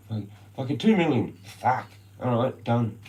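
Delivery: clinching the sale, pumped; excited deal closing. Retold or imitated. Agent shouting as he clinches the two million deal, excited.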